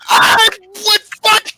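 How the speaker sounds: loud, high, slow strained outburst; overwhelmed. Real, not acted. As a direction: cry out as if you can barely cope. Overwhelmed by imagined flirting from crush.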